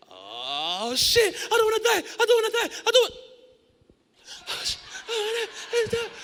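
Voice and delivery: very high, loud terrified shrieks; panicked screaming. Retold or imitated. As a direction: scream for your life on the drop.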